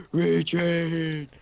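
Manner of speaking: imitated raspy drawn-out call; mock raspy battle cry. Teasing Brett's background voice, imitated raspy shout. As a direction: lightly mimic a raspy commander. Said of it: "raspy voice in the background going".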